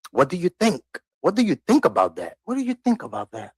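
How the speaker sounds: high mocking patronising ask; patronising question. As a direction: parrot the board talking down. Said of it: "they may ask".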